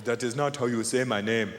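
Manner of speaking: low, measured voice of an offended man; other man's voice. Acted. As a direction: correct them in a low put-on voice. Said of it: "He goes".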